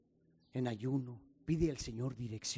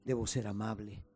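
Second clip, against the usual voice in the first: louder, rising pastor's prayer building in force; fervent prayer; 5 dB louder than usual. Acted. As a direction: pray aloud to the Father with growing fervour. Pastor leads closing prayer.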